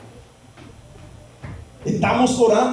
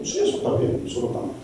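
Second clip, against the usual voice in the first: hushed reply; 10 semitones lower, 8 dB quieter, much faster than usual. Acted. Pastor answers the quoted question.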